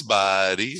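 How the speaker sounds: imitated stoner, lilting up on the last word; Pauly Shore. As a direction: do a goofy SoCal stoner's funny voice. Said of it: "He talked like this".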